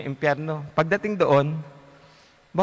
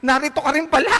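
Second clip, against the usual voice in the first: much higher, loud astonished yell; shout; 9 semitones higher, 4 dB louder than usual. Acted. Soul spots his priest in hell, shouting in surprise. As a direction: yell out in shocked recognition. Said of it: "napasigaw siya".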